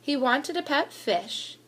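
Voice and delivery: ordinary delivery, nothing marked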